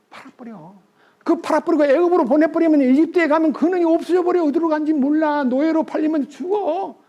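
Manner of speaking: 살짝 높여 연기한 유다; 형제들을 설득하는 유다를 가볍게 흉내 내듯; Judah's voice. Acted. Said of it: "네 번째 아들 유다가". (유다가 형들에게 요셉을 팔자고 조르는 가벼운 목소리)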